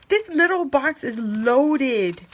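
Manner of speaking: usual speaking voice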